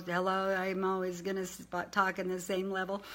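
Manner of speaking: low, soft, one-note drone; monotone. Shown as a demonstration. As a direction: drone it all at the same low level. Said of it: "Don't be monotone".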